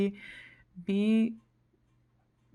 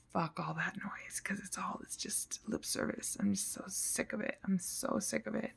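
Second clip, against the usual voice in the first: very soft, half-whispered weariness; fed-up venting; 10 dB quieter than usual. Real, not acted. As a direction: let the exasperation drop to a near whisper. Fed up, venting about lip service.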